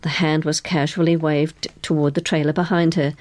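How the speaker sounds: ordinary delivery, nothing marked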